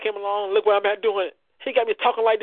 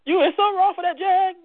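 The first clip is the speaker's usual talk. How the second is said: high mock scolding voice of Taylor; mimicking Taylor; 12 semitones higher than usual. Jokingly imitating Taylor scolding him. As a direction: put on her teasing scold, pitched up. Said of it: "she probably gonna kill me after the show".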